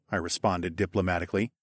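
ordinary delivery

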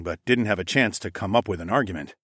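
plain reading voice

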